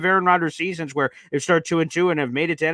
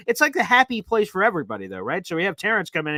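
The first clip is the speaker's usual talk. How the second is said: ordinary delivery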